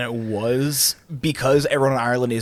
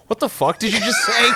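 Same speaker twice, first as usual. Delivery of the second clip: high, laughing mock outburst; incredulous, laughing; 12 semitones higher than usual. Teller quoting bartender after misheard "ninety", laughing. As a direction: imitate disbelief while cracking up.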